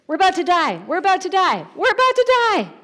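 high-pitched cries climbing each time; adrenaline panic. Acted. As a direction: cry out in mounting mock panic. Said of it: "Imagine the adrenaline rush here".

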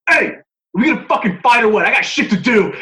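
imitating a loud, high-pitched challenge; impatient shout. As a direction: bellow it like you want this over with, as retold. Acted impatient cowboy challenging a fighter.

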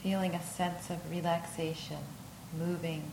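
unhurried yoga nidra voice with long pauses; guided meditation. Acted.

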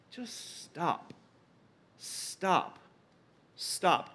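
soft, isolated words with pauses between; quiet, spaced-out repeats of "stop"; hushed stop. Shown as a demonstration. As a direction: say it quietly and let silence follow. Demonstrating an interlude, a pause.

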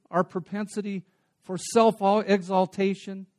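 loud, driving sermon voice, slowing on the key words; preaching at full force. Acted. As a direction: preach it out to the whole hall.

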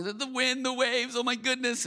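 high-pitched imitation of flustered disciples; mock panic.

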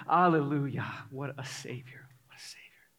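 reverent whisper, fading away; hushed awe. Real, not acted. Preacher responding to the old hymn, whispering in awe. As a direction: whisper it as worship, almost to yourself.

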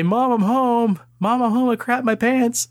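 put-on high child voice calling out; wailing little kid. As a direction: cry for mom like a scared kid.

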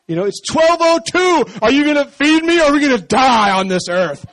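high, loud, melodramatic stomach voice; whining stomach. Pretend. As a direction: beg for food like it's life or death. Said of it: "He's like".

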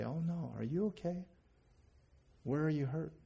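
acted tender alarm; concerned parent. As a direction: fuss gently over a hurt child. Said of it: "it's hard for me to say".

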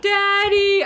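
put-on high whiny little-kid call; imitating child calling. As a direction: call out like a sleepy toddler.

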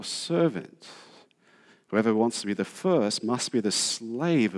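slow and weighty, pausing between phrases; slow, deliberate reading. Shown as a demonstration. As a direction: read it slowly, phrase by phrase. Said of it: "I'll say this slowly".

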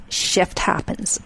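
slow, hushed, almost whispered; deadpan punchline. Acted. Host closing the MS interview with a slow hushed pun. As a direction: let the pun land quietly and slowly.

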